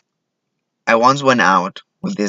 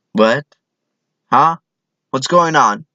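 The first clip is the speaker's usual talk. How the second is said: mildly bewildered, a touch higher; puzzled little questions; clueless confusion; 4 semitones higher than usual. Acted. Comic acting out his own cluelessness, confused.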